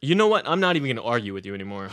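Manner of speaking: finally losing patience, you know what; exasperated outburst. Real, not acted. Guest fed up with the host, a loud exasperated outburst.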